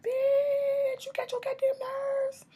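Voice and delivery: very high, shrill-voiced scolding with a whispered middle; sassy scolding. Scolding the catfisher in a very high sassy put-on voice. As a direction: scold him in a squeaky put-on voice. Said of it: "I said".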